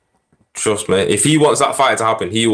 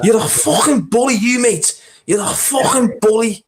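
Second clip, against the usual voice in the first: high-pitched mock Scouse; imitating a Scouser, pitched right up; Scouse accent. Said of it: "That's quite a good Scouse accent".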